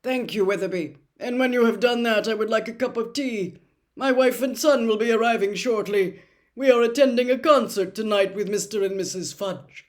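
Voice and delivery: calmly dictating to an imagined clerk; deluded official. A narrator's reading. Crouch chatting to tree.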